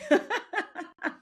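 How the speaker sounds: a high, airy giggle; embarrassed laugh. Real, not acted. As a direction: laugh at your own clumsy words. Laugh at own awkward wording.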